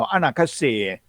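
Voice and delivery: ordinary delivery, nothing marked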